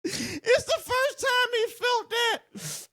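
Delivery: laughing to tears; high, wheezing laughter; crying laughing. Real, not acted. Laughing fit at the video.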